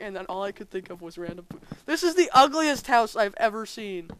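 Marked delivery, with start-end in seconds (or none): 1.9-4.1 loud, raised-pitch outburst of disbelief; loud exclamation. Real, not acted. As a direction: exclaim it loudly at the ugly sight. Exclaims over ugly game house.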